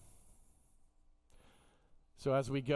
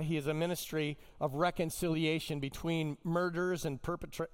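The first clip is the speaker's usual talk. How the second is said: ordinary delivery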